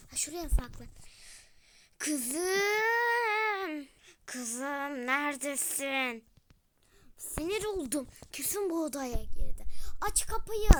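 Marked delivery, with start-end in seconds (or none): 2.0-6.2 mother calling out. Acted. Mother searching for daughter. 7.2-10.7 angry mother. Acted.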